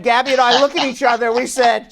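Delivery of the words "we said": normal talking voice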